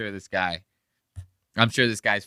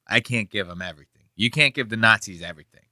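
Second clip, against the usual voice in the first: ordinary talk, nothing marked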